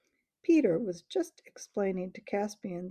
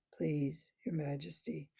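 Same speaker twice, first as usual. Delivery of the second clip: sleepy bear voice; 4 semitones lower, 6 dB quieter than usual. Acted. The bear pleads again.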